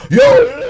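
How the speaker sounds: very high, loud warbling holler; mock Swiss yodel cry. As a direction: belt out a shrieking comic yodel. Imitating a Swiss call for joke.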